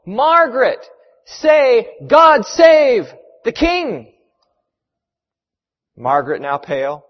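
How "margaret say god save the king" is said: high, drawn-out shout across distance; loud pleading yell, stretched at the end; yelling from shore. A narrator's reading. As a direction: call out urgently to someone far off. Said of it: "Someone from shore yelled".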